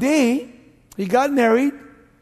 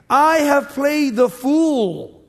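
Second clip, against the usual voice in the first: higher, very slow confession; drawn-out confession; 6 semitones higher, 4 dB louder, much slower than usual. Acted. Higher and very slow. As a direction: Saul owns his folly to David.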